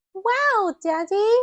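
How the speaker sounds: put-on high, loud amazed child; little piglet thrilled by Daddy; excited Peppa. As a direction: cry out in wonder. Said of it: "Peppa cries".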